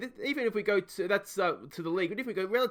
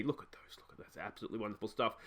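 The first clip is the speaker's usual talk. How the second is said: whisper; 8 semitones lower, 11 dB quieter, faster than usual. Real, not acted. Admiring stats under breath.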